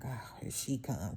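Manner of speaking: low, slow, under-the-breath dread; wary aside. Retold or imitated. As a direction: mutter it like you see trouble coming. Dreading coworker approaching.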